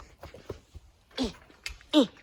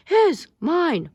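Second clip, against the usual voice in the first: high loud bickering; squabbling; 11 semitones higher, 5 dB louder than usual. Acted. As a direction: bicker like a child. Quarrel continues.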